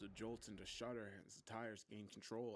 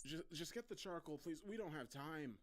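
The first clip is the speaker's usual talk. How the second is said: doctor cutting in, pitch raised; urgent impatience; 7 semitones higher than usual. A narrator's reading. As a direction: press a colleague to hurry. Doctor cuts in during emergency.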